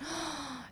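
quick, breath-only gasp; gasp of realisation. Retold or imitated. Preacher realising the struggle was worth it, soft gasp. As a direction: suck in air as relief dawns.

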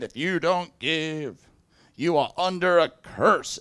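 slow, weighty imitation of a preacher; mock pulpit pronouncement, drawn out; preaching minister. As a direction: pronounce it slowly like a cursing minister. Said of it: "a guest minister stood up".

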